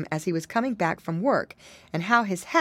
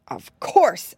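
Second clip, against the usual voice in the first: a touch of bold agreement, louder; bravely; 3 semitones higher, 6 dB louder than usual. Acted. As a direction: agree with puffed-up courage. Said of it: "agreed Curly bravely".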